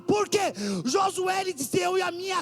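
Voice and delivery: high, loud shouted sermon; fiery preaching. Acted. Preacher shouting to the congregation, fiery high preaching. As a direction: preach it full force from the pulpit.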